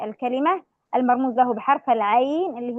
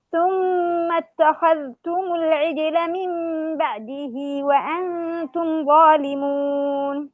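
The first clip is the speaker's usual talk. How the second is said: slow chanted verse, raised pitch; Quranic recitation; 4 semitones higher, slower than usual. Shown as a demonstration. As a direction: recite ثم اتخذتم العجل twice, drawn out. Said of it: "قوله تعالى". (ترتيل بطيء بصوت مرتفع)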